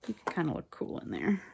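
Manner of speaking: ordinary talk, nothing marked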